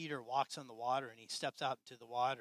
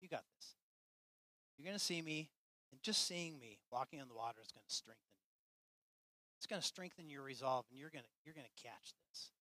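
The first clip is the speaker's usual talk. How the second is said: softer, lightly voiced reassuring Jesus; gentle reassurance; 7 dB quieter than usual. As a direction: comfort them quietly. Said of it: "Jesus is like".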